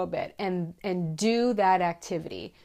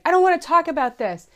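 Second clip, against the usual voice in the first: higher, louder, fast brush-off; reluctant spouse; 10 semitones higher, 9 dB louder, faster than usual. Pretend. Spouse refusing to discuss emergency plans, high fast brush-off. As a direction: push the topic away quickly. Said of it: "if your spouse is like".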